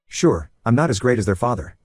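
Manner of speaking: ordinary delivery, nothing marked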